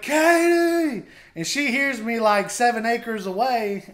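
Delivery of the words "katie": long, high holler of a name. Retold or imitated. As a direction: holler for someone acres away, drawn out and high. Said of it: "I holler".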